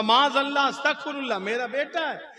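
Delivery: high, loud mock horror; scandalised protest. Cleric protests to the Sunni, scandalised. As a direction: burst out shocked, easing slightly by the end.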